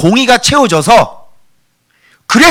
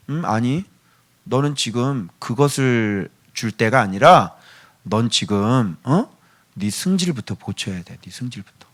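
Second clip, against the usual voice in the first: calm refusal; 8 semitones lower, 7 dB quieter than usual. Acted. God gently refuses the prayer.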